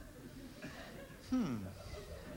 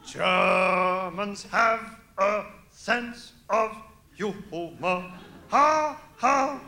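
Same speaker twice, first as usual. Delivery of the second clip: mock German; much slower than usual. Comic German lecturing audience.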